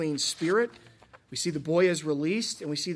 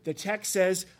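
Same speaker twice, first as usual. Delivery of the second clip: usual speaking voice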